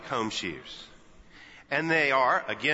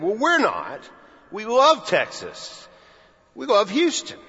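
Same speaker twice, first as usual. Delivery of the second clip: louder, higher, firm declaration; a louder, higher, emphatic comeback; proud retort; 7 semitones higher, 6 dB louder than usual. Retold or imitated. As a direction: plant it proudly like a Texan answering back.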